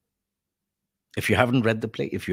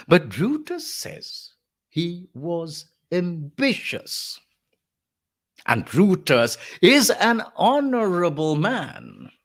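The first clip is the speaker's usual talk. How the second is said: slow, raised, drawn-out sarcasm; sarcastic; 5 semitones higher, slower than usual. Acted. Lecturer as Antony throwing Brutus's words back, slow sarcasm. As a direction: stretch the words mockingly, pitched up. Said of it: "If there's sarcasm, is it coming through".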